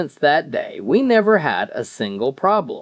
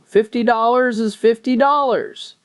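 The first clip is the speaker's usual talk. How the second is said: higher, put-on old man voice; deadpan punchline; 10 semitones higher than usual. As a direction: echo the wife's stingy line dryly. Husband's deadpan callback punchline.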